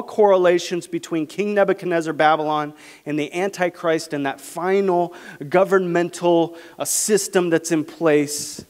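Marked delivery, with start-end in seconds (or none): none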